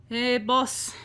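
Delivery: henchman calling. Acted. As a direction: call lightly through the boss's door. Henchman knocks on boss's door.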